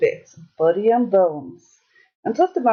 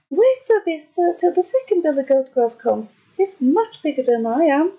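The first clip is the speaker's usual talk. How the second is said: high-pitched goat bargaining; small-voiced little billy goat; 7 semitones higher than usual. A narrator's reading. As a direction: talk your way out in a tiny voice. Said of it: "with such a small voice".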